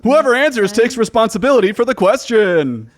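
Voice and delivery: loud, high quiz host voice; game show announcer. Acted. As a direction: rattle off the rules like a quiz host.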